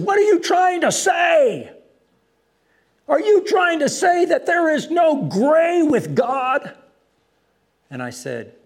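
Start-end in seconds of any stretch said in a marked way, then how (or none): 0.0-6.7 high, slow, drawn-out retold shout; full-voiced heckle from the crowd. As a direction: imitate an upset heckler yelling at the preacher. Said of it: "started shouting".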